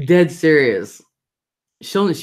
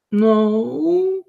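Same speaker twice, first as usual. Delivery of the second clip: imitating a tearful little girl, very high; high, drawn-out mock whimper; crying child; 11 semitones higher than usual. Grandma mimics her scolded granddaughter, crying child. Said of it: "She was crying".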